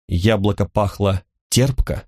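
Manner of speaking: usual speaking voice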